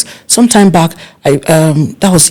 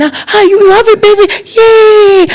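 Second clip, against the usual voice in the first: delighted cheer. Retold or imitated. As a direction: squeal with joy at the news. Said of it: "I will call again".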